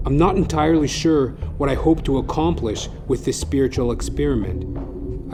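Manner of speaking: ordinary delivery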